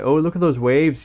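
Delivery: hammed-up Canadian accent; imitated Canadian accent. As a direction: do an enthusiastic impression with a Canadian accent. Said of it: "he had that sort of accent".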